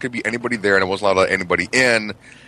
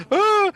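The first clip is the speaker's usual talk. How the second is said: high-pitched mock gasp of fright; startled yelp. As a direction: imitate a startled kid's yelp. Startled child as mom walks in.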